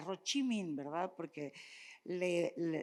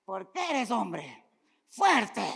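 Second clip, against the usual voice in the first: loud, higher mock command to a boy; imitating what men were told; stern order; 3 semitones higher, 12 dB louder, much slower than usual. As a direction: bark it like a strict father. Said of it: "a los hombres les dijeron".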